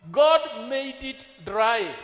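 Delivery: ordinary delivery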